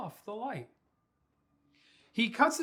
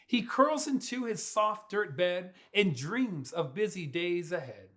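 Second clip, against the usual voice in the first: plain reading voice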